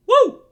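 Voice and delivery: very high, loud "woo"; excited whoop. Real, not acted. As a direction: let out a celebratory whoop. Cheer capping the celebration.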